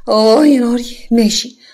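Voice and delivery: loud indignant cry; enraged outburst. Acted. Yara loudly indignant at being called a maid. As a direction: flare up at the insult.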